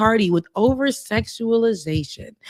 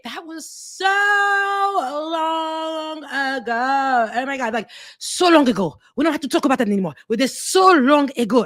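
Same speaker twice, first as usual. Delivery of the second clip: mock French accent; 3 semitones higher than usual. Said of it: "I gotta do, you know, they accent".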